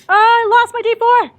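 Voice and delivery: high, loud cry of dismay; comically distraught outburst; dismayed wail. Real, not acted. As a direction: wail over a lost game die. Player wails over lost die.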